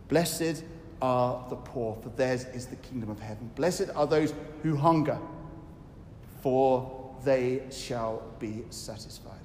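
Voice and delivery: solemn scripture reading. Shown as a demonstration. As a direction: read the blessing gently and low. Preacher reads Beatitudes.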